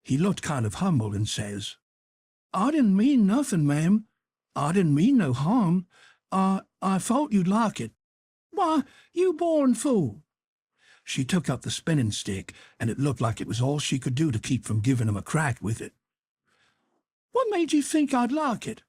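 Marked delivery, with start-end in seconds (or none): 2.5-7.9 put-on higher, pleading boy; a boy playing innocent; put-on contrite apology; mock-humble Tom. A narrator's reading. 8.5-10.2 higher, slow outraged drawl; an aunt scorning a fool; indignant auntie; exasperated scold. A narrator's reading. 17.3-18.8 an aunt scorning a fool; indignant auntie; exasperated scold. A narrator's reading. Aunt Sally demands.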